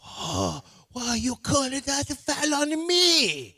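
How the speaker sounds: put-on high, slow, loud Argentinian accent protest; mock a soccer player in an Argentinian accent. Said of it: "you're hurting my feelings by doing an Italian accent".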